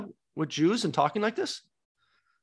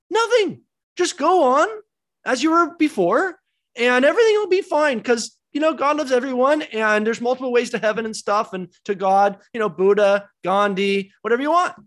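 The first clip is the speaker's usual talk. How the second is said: much higher, sing-song mocking voice; mock wishy-washy preacher; 5 semitones higher, 4 dB louder than usual. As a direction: ham up a spineless modern bishop. Parody of a spineless Peter.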